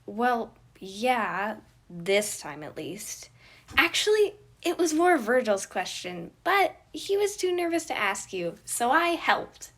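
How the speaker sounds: put-on high, loud, bouncy little boy; hyper kid voice. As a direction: chatter like an overexcited child.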